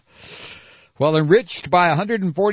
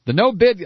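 no-bid punched high and loud; stress on no-bid; 6 semitones higher, 4 dB louder than usual. Shown as a demonstration. Reader savoring the phrase no-bid with a stressed no-bid. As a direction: lean hard on "no-bid". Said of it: "I love this".

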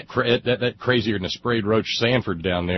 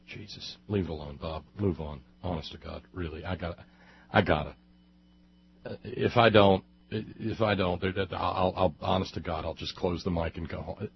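much softer, talking under his breath; muttering to himself; 6 semitones lower, 9 dB quieter than usual. Real, not acted. Host scolding himself under his breath to move on. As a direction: grumble to yourself to drop it.

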